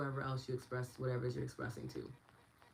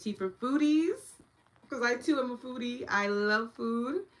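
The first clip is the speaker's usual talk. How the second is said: excited; 9 semitones higher, 8 dB louder than usual. Real, not acted. Gushing about being a foodie.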